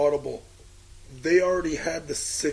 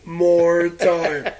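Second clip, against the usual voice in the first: drawn out; 3 semitones higher, 8 dB louder, much slower than usual. Real, not acted. Joking drawn-out line.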